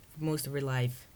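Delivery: usual speaking voice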